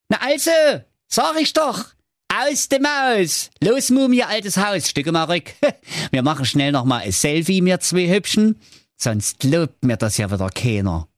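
high, brash braggart voice; cheeky swagger, calling out; cocky prince calling out. A narrator's reading. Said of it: "Und der Prinz rief". (großspuriger Prinz, laut rufend)

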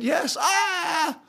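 very high-pitched celebratory squeal; crazed excitement. Retold or imitated. Singer hanging up the phone, acting out crazed joy. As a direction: squeal the news, then wail with joy. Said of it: "went around the house like crazy".

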